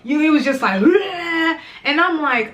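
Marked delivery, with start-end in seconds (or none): 0.8-1.6 mock vomiting noise. Imitating vomiting.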